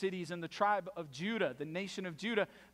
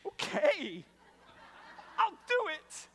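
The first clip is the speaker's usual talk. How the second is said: gleeful eagerness; 13 semitones higher, slower than usual. Retold or imitated. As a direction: jump at it like a delighted tattletale sibling. Gleeful sibling answers the summons.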